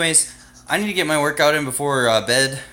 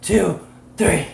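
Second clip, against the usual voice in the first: loud, higher counting; 8 semitones higher, 5 dB louder, slower than usual. Real, not acted. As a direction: count it out loud to cheer him on. Counting down for a handstand.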